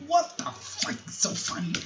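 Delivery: high, breathy whispered movie line; whispered quote. Retold or imitated. Host quotes the famous line.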